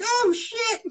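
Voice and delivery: a sudden very high startled yelp. Retold or imitated. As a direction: jump at it, caught off guard. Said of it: "be like".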